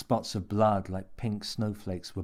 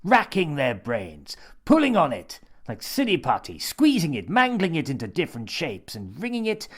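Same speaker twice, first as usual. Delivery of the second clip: building excitement; 6 semitones higher, 5 dB louder than usual. A narrator's reading. Stranger building, rising excitement.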